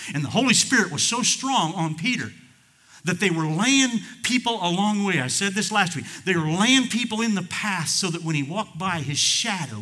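loud, high preaching at full force; fervent preaching. Acted. Preacher telling of the Holy Spirit on Peter, fervent. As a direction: belt it out to the congregation.

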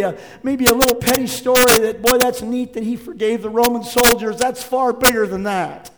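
loud, high, driving sermon delivery; full-force preaching. Acted. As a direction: preach it out to the whole room.

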